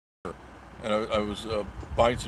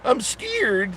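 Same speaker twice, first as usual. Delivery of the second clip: much higher, hammed-up scared voice; mock fear; 10 semitones higher, slower than usual. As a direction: whimper it like a scared kid, as a joke. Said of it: "and I'm not frightened of that".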